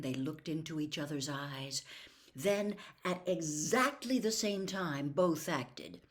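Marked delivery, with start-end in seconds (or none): none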